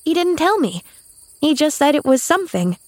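put-on high, innocent child voice; earnest young girl. A narrator's reading. Girl admitting she wasn't told, innocent. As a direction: answer simply as a young girl.

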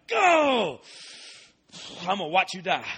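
high, loud, mocking cry at the bee; gleeful taunt. Preacher mocking a bee that stung him, gleeful taunt. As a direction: gloat over the dying bee.

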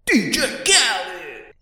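DJ hype call. Retold or imitated. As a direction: announce it like a DJ shouting his tag at the top of a song. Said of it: "The D J has to announce their name".

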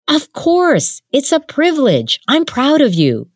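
lightly voiced young man, proud; Jin's voice. A narrator's reading. Jin telling Suja he's proud, lightly voiced.

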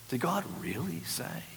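hushed, inviting snake voice; appealing serpent. Acted. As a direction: coax softly, as if inviting doubt. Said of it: "it sounds appealing".

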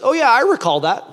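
high, breezy passing remark; casual recollection. Shown as a demonstration. Showing how Americans use the word recall, casual recollection. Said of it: "we say it like this".

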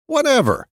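high, flippant throwaway; casual high toss-off; breezy whatever. Real, not acted. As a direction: shrug it off. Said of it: "end of a list".

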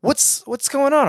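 lightly acted concern for a stranger; concerned question. Retelling how he asked the woman what was wrong, slow and high.

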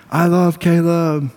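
high, drawn-out sing-out; playful declaration. Retold or imitated. Dad pointing at his toddler in a love game, playful. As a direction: imitate a dad's playful call, stretched out. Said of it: "we'll just get louder and louder".

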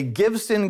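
"Gibson" as Givson, drawn out slowly. Shown as a demonstration. Preacher reveals the knockoff guitar, saying "Gibson" as Givson.

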